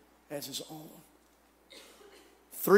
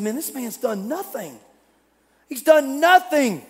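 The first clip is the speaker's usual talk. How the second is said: pleading defence; 7 semitones higher, 3 dB louder than usual. Acted. Criminal defends Jesus, building.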